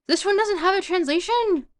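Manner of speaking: high, loud incredulous question; loud disbelief. Real, not acted. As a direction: cry out in dismay at what's missing. Dismayed at missing translation.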